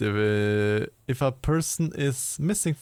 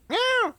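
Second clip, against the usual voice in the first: imitated meow. Imitates a cat in chat.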